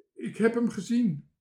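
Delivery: high, loud blurting; urgent outburst. A narrator's reading. Bange Toontje reports seeing the lost sailor, blurting. Timid character blurts out news.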